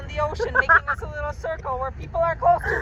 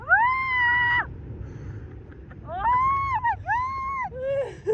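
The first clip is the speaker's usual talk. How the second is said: high drawn-out shriek; terrified scream; 5 dB quieter, much slower than usual. Real, not acted. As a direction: scream as you fall. Falling toward the sea.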